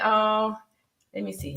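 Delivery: ordinary talk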